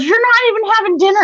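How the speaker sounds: fast, high-pitched mock scolding; scolding mom. Mom sending child to bed unfed, fast high scolding. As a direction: hand down punishment as an angry mother.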